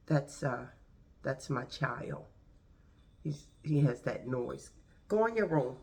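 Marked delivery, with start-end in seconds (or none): 5.1-5.8 sharp, raised, higher order. Real, not acted. Ordering child to his room.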